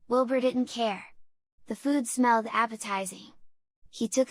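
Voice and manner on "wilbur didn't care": ordinary delivery, nothing marked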